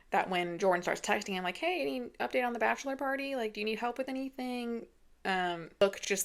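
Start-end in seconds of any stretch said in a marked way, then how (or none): 1.6-4.9 casual check-in. Retold or imitated. Voicing a friendly check-in text.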